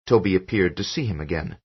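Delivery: usual speaking voice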